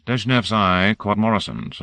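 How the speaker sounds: ordinary delivery, nothing marked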